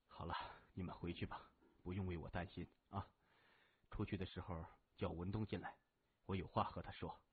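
low, soft, quick murmur; injured brother, reassuring. A narrator's reading. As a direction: weakly send the sisters off. (虚弱低声地嘱咐)

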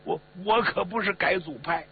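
high, stammering self-defence; frightened pleading. A narrator's reading. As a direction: stumble over a panicked denial. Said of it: "吓得面色灰黄啊， 赶紧辩解".

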